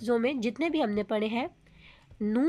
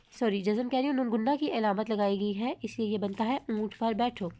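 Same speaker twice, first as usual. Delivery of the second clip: ordinary talk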